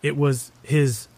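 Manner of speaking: slow, unnatural, broken-up delivery; halting words, as though struggling to communicate; disjointed. A narrator's reading. Eerie stranger replying to the driver, disjointed. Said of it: "the words sounded disjointed and unnatural".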